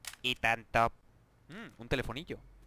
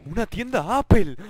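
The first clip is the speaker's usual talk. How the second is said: excited exclamation; 5 semitones higher, 11 dB louder than usual. Real, not acted. Emoción al ver la tienda.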